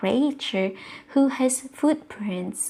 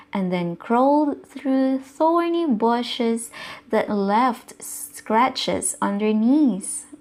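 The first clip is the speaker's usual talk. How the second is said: plain reading voice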